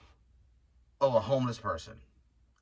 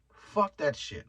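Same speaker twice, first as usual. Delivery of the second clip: ordinary talk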